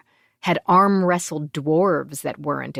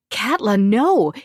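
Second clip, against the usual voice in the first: loud, slow pleading cry; cried out; 6 semitones higher, 6 dB louder than usual. A narrator's reading. Gemma cries out to Katla to stop her, loud and pleading. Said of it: "Gemma cried".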